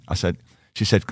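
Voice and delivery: usual speaking voice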